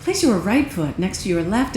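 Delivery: a hint of a broadcaster reading; lightly voiced news anchor cue. Shown as a demonstration. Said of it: "cue Warrior Two in the news anchor voice".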